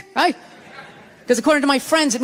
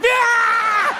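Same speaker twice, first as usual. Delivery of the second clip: high, drawn-out snarling yell; attacking dog; 10 semitones higher than usual. Acted. Dog going for a passerby, wild attacking cry. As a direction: explode like a lunging dog.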